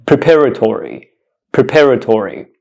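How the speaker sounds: "preparatory" said pruh-PAR-uh-tory, falling; "preparatory" with a reduced first syllable and a falling end; correct pronunciation. Shown as a demonstration. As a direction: model "preparatory" for careful listeners, short "pruh" then PAR. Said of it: "Listen carefully".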